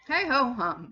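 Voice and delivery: resigned but cheery, lifted in pitch; cheerfully repressed sigh. A narrator's reading. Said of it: "cheerfully repressing a sigh".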